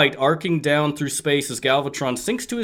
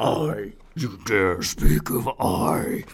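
low, slow, breathy ghost whisper; deep ghostly voice; 6 semitones lower, much slower than usual. Acted. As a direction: drag it out like a dark spirit. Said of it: "I was doing a ghostly voice".

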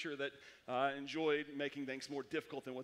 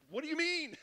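high-pitched, baffled question; mock incredulity; 10 semitones higher, 4 dB louder than usual. Student to classmate about following instructions, comically incredulous. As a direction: play up dawning dismay. Dawning realisation, acted with laugh.

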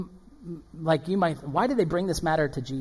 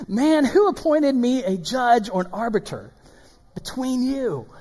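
slightly raised, measured indignant question; restrained dramatic scripture reading; toned-down inflected reading; 5 semitones higher than usual. Acted. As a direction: read it with milder mock indignation. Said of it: "I just have fun reading that".

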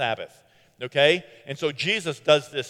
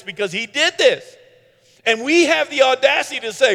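loud, high-pitched preacher's cry; preaching at full force; 7 semitones higher, 6 dB louder than usual. Acted. Preacher rousing the church, loud and fired up. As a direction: drive it home to the congregation.

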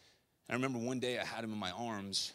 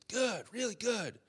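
put-on cheerful old man; 13 semitones higher, 5 dB louder, slower than usual. Older gentleman answers cheerfully.

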